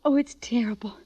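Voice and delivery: hushed, scared whisper; frightened whisper. Acted. Sadie, frightened, whispers to Sam about the pad. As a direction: breathe it out in fear. Frightened wife tells husband late night.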